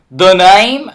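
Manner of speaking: badly faked cowboy accent; terrible cowboy accent. Player retrying the bandit's line, bad cowboy accent. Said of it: "that's a terrible cowboy accent".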